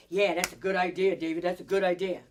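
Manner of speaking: loud, quick chorus of approval; enthusiastic group approval. Retold or imitated. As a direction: mimic colleagues cheering on the boss's pet. Acted room praising rival's idea.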